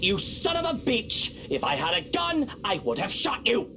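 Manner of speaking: high, slow furious outburst; startled angry outburst. Acted. Lord startled by Johanna, high angry outburst. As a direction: snap at him after the scare.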